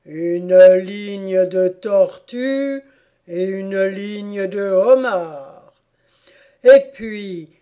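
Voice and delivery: loud, slow, ceremonious explanation; emphatic storybook creature. Acted. Creature explains the lobster dance to Alice, slow and emphatic. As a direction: lay out the dance loudly and with relish. Voiced figure describing the dance.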